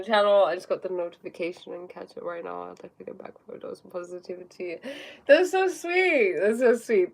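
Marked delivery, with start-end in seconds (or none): 5.3-6.4 high, slow, drawn-out coo of delight. Real, not acted. Touched by a viewer's kind comment, a high slow coo. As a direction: melt over the compliment, stretching it out.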